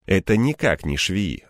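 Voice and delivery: plain reading voice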